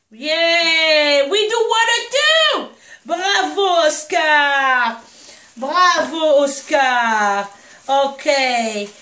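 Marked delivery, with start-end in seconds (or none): 0.1-7.5 loud, drawn-out bravos; celebratory cheering. Real, not acted. Owner to puppy Oscar for taking water, celebratory cheering. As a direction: cheer a little one as if they had won.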